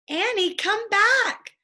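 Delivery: high, loud, slow shout after her. Acted. Jack shouts after Annie running down the hill, high slow shout. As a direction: yell it after her as she runs downhill. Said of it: "Jack shouted".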